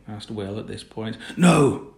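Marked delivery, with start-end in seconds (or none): 1.4-1.9 "No" thrown out loud and high; extraordinary emphasis. A narrator's reading. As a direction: hit "No" with sudden, forceful refusal. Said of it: "with extraordinary emph-, emphasis".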